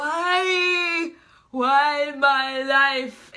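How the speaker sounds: high, drawn-out wail of despair; mock wailing. Reader acting out a character's dismay, theatrical wail. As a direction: wail it long and loud, hammed up.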